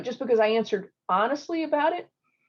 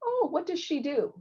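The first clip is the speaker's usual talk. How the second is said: higher, eager question; eager follow-up question; 8 semitones higher than usual. Retold or imitated. Interviewer picks up on the girlfriend, eagerly curious. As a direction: ask it with lively interest, pitched up. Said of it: "My girlfriend was really excited".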